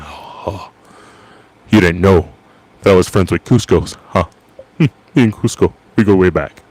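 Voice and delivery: a hint of a smug, nasal deadpan tough guy; Patrick Warburton impression. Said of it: "Patrick Warburton's got that really deep voice, and he nasalizes it up".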